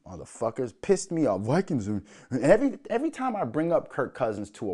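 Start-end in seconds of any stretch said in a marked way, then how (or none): none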